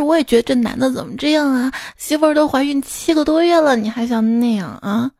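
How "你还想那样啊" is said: ordinary delivery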